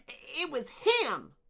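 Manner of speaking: very high, loud and slow shriek. Acted. Torin shrieking an accusation at Dune, very high. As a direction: shriek it, pointing. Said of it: "he shrieked".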